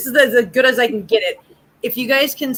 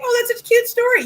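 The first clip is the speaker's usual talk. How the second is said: much higher, mock sugary quote; mock sweet gush; 11 semitones higher than usual. Mimicking friends who fake interest, sugary high gush. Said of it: "you're like".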